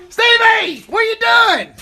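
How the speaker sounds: loud high call across the yard; high, loud call to Stevie. Real, not acted. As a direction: shout to get Stevie's attention. Calling out to Stevie.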